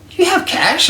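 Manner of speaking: very high, loud squeak of disbelief; incredulous. Retold or imitated. Asking a broke friend about his cash, pitched very high in disbelief. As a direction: shoot the pitch way up in shock.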